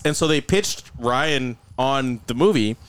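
usual speaking voice

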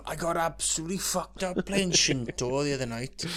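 mock-sheepish loud confession; hammed-up apology; apologising to mum. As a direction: own up to mum in a put-on voice. Plays child apologising to mother.

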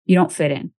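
ordinary delivery

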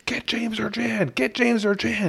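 high, slow frantic call for James or Jan; retold scream. Husband yelling to his wife to fetch James, high and frantic. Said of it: "I'm screaming at my wife".

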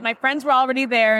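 normal talking voice

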